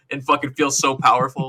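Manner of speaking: ordinary talk, nothing marked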